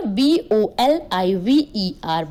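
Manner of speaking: slow, letter by letter: B O L I V E R; dictating each letter of B O L I V E R; spelled out letter by letter. Shown as a demonstration. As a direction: spell Bolivar out slowly for the class.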